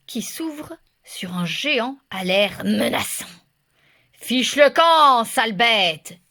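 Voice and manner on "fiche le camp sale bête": loud, slow menacing roar; giant's shout. A narrator's reading. Giant at the door chasing off the wolf, slow loud roar. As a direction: bellow to drive the beast off. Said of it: "crie le colosse".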